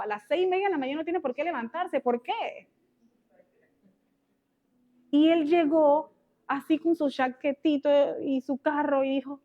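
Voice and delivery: usual speaking voice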